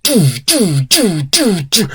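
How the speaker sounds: high, falling "doo" sounds; cartoonish repeated sound effect; mock spurting noises. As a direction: imitate repeated squirts with swooping notes. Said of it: "they're just like".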